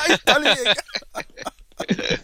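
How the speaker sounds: slow words through laughter; laughing. Real, not acted. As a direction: laugh it out high. Laughing at the boo.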